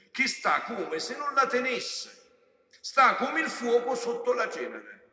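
loud, high-pitched proclamation; impassioned preaching. Real, not acted. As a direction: preach it at full force, stretching key phrases. Preaches at full force.

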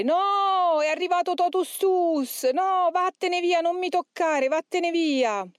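high, drawn-out demonic howl. A narrator's reading. Demon driving off John Paul II, high desperate howl. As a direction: cry out high as a tormented demon. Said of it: "il demonio cominciò a urlare".

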